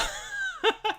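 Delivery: squeaky burst of laughter; high-pitched laugh. Real, not acted. Laughs at dolly grip.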